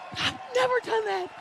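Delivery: excited shout. Retold or imitated. Disbelief after record.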